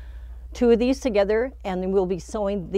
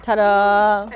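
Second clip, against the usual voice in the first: loud, drawn-out reveal with a laugh; triumphant ta-da; 9 dB louder than usual. Real, not acted. Teacher finally gets the block together, loud triumphant reveal. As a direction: belt out a proud ta-da.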